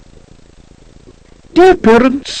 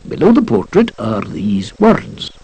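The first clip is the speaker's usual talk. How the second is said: usual speaking voice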